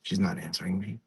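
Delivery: hushed acted grumble; quiet aside. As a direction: drop right down for a private remark. Muttered aside in the impersonation.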